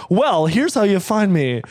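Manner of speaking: presenter voice. Retold or imitated. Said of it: "look directly at the camera and be like".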